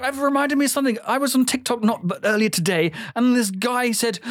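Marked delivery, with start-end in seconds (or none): none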